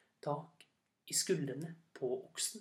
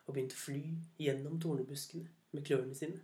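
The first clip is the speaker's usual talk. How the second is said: plain reading voice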